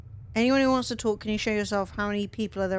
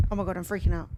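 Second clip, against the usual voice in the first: ordinary talk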